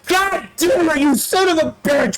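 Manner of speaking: high-pitched burst of exasperation; cursing at yourself in frustration; exasperated self-scolding. Real, not acted. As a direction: berate yourself, starting high and easing down. Scolds self after wrong guess.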